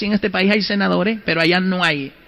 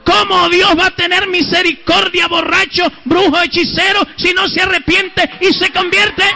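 fiery preaching; 7 semitones higher than usual. Retold or imitated. Preacher's condemning sermon quoted.

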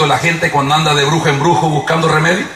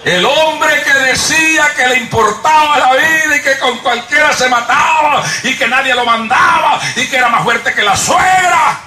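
mock boast; 7 semitones higher than usual. Preacher mocking the proud king.

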